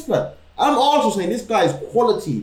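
high, worked-up indignation; excited. Real, not acted. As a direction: push it up, outraged and amused. Indignant roadman rant.

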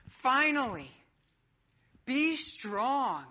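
loud, high rallying cry; big, emphatic coach-style exclamation; excitement boiling over. Acted. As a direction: proclaim it like a pump-up talk. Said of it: "hear the excitement boil over".

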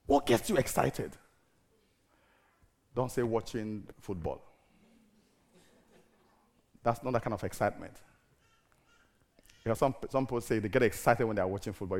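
ordinary talk, nothing marked

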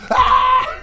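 sudden high shriek of fright; loud high mock scream; startled scream. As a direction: shriek as if scared out of your skin. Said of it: "She just screams".

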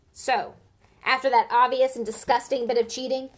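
loud, disgusted commentating; indignant commentary. A narrator's reading. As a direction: sneer at the cheating. Biased commentator.

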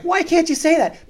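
very high, loud challenge; imitated loud protest. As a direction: shout back in mock indignation. Passenger argues back, retold.

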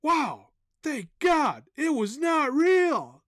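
high, slow drawn-out relief; swooping high-pitched mock relief; relieved waking reaction. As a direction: draw out each word in hammed-up amazement. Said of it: "Then I wake up and I'm like".

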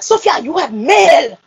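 high, slow, accented mock-angry dad; real angry. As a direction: imitate Dad's angry announcement, drawn out. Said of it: "talk to me real angry".

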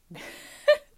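a burst of high, loud laughter; a quick high laugh that breaks out; sudden loud laugh. Real, not acted. Vlogger alone in the closet suddenly bursts out laughing.

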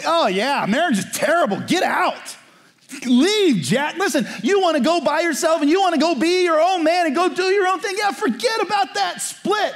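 high, scoffing friend voice pushing divorce; mock bad advice. Preacher mimics a friend urging divorce, high and mocking. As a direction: ham up the careless friend telling him to leave.

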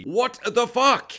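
slow, high, emphatic astonishment; stunned disbelief. Acted. Viewer reacts to invisible ghost girl killing, stunned.

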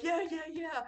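mock the crowd's hype; crowd cheering. Imitating people at the floor cheering his cartwheel excitedly. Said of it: "people are like".

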